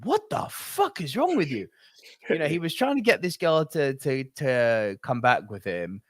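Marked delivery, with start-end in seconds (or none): 0.0-1.7 appalled adult. Retold or imitated. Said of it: "the adult in me is like".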